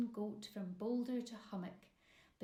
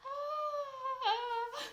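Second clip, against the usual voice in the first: high, loud terrified shriek; boy's scream; 7 dB louder than usual. A narrator's reading. Boy screams on seeing the giant's face. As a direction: shriek in sudden fright. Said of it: "The boy screamed".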